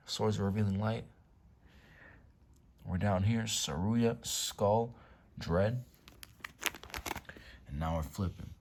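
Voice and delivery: ordinary talk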